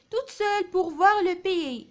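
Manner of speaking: very slow, projected reading; poem read aloud. Shown as a demonstration. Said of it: "نقراو الشعر هذا مع بعضنا".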